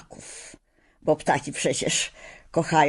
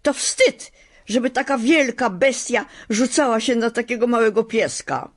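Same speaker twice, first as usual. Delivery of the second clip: higher, indignant telling-off; scolding girl; 6 semitones higher than usual. A narrator's reading. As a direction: shame the big beast, sharply.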